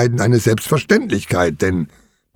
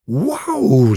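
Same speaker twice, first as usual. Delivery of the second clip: drawn-out, delighted wow; enthusiastic; 2 semitones higher than usual. A narrator's reading. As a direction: let the wow swell with enthusiasm. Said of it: "sagt er begeistert".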